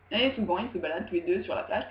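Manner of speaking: normal talking voice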